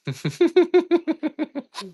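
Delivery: long, very high wheezy giggle; giggling. Real, not acted. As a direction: giggle helplessly at your own story. Laughs at daughter's complaint.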